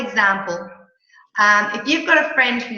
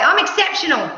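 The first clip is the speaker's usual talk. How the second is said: bright, emphatic I'm exceptional; upbeat answer; 6 semitones higher, 3 dB louder than usual. Shown as a demonstration. Coach showing the client an enthusiastic answer to how are you. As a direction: answer like the day is wonderful. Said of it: "in the Australian language".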